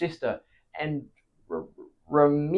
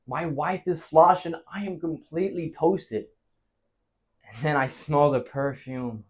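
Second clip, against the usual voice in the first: ordinary talk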